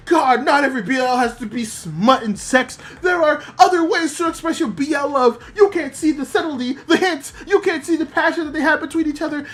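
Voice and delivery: a touch of an indignant fan, half laughing; mock BL fan rant.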